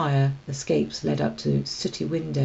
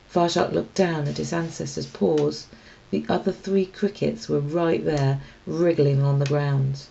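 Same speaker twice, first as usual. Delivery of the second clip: ordinary delivery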